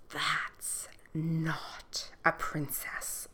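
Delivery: slow unvoiced whisper. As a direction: whisper it aside, very slowly. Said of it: "whispered the queen".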